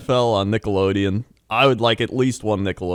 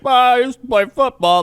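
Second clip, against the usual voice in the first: high, loud mock of a pompous ex-player; mocking old announcer; 7 dB louder than usual. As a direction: ham up a smug former pro. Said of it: "that was just like".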